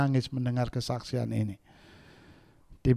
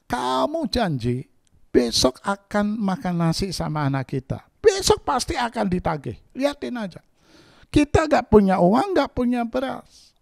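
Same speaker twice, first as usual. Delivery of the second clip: high, pleading wife's voice; wife's complaint; 9 semitones higher than usual. Retold or imitated. Wife pressing her pastor husband, higher and pleading.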